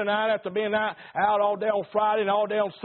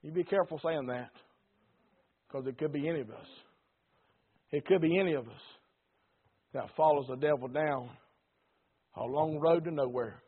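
softer, lower grave caution; solemn warning; 6 semitones lower, 6 dB quieter than usual. Acted. Preacher cautioning the congregation, soft and grave. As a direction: warn them gently but gravely.

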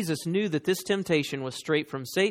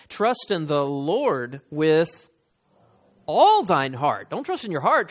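slow, louder, ringing proclamation; preaching; 3 semitones higher, 5 dB louder than usual. Acted. Preacher urging the congregation to trust the Lord, loud and slow. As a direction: drive each line home to the pews. Preaching Proverbs at full force.

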